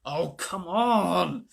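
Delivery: slow, drawn-out grumbling growl; a sulky boy growling his protest. A narrator's reading. Sleepy Edmund grumbles at being dragged out, growling. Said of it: "growled Edmund".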